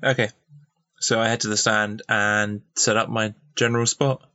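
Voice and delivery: ordinary delivery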